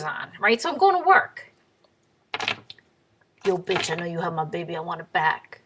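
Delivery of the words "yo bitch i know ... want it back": fast demand, slightly lowered voice; a hint of an accosting street guy, quick and low-key; lightly voiced street aggressor. Voices the big man accosting her.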